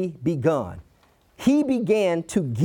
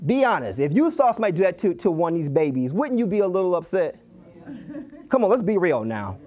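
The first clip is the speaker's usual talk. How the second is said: normal talking voice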